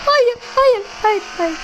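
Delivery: alarmed no. Real, not acted. Player watching car tip over, alarmed protest. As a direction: beg the car not to flip.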